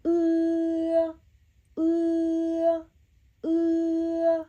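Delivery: "e" said loud from the back of the throat with the jaw lowering; loud Mandarin e. Shown as a demonstration. Teacher shows jaw movement for "e", loud demo. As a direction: drop the jaw and push out each "e". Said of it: "the way how you actually need to pronounce this sound".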